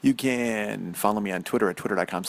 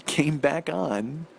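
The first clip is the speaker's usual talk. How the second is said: ordinary talk, nothing marked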